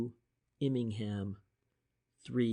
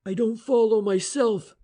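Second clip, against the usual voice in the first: higher, louder brisk inspector; brisk reply; 10 semitones higher, 8 dB louder than usual. A narrator's reading. French answering Shane's confusion, briskly. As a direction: answer crisply. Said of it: "French returned briskly".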